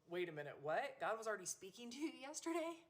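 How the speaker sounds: a mother replaying her own shock, voice jumping up; astonished. Mother recalling her daughter's news, surprised and rising. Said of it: "And I'm like".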